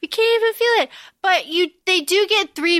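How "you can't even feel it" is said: mock Effie. Echoing Effie's line.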